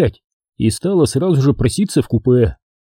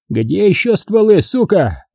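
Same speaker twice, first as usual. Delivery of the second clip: sergeant yelling; 8 semitones higher, slower than usual. A narrator's reading. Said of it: "громко орал сержант".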